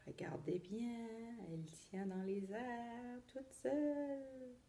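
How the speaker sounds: hushed amazed whisper. Acted. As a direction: breathe it so the wand stays up. Floating wand reveal.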